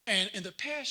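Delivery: ordinary talk, nothing marked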